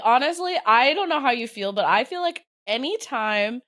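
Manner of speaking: ordinary talk, nothing marked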